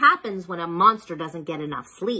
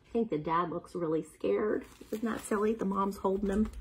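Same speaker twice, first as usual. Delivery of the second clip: much softer, confiding; soft aside; 10 dB quieter, faster than usual. Real, not acted. Reader points out the picture to children, softly. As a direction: murmur it as a side comment.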